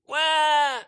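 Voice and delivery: put-on high shouted baby wail with temper; mock angry baby cry. Preacher to children, imitating a baby crying in protest. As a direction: wail like a baby held too tight. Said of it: "he just cries and cries".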